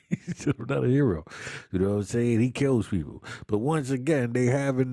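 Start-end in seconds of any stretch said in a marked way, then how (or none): 0.1-1.2 laughing through the words. Real, not acted. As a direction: chuckle while saying it. Laughing while repeating.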